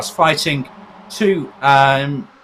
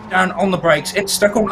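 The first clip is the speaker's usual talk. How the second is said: excited; 6 semitones higher, 3 dB louder than usual. Real, not acted. Cars touch live.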